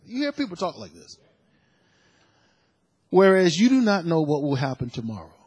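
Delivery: normal talking voice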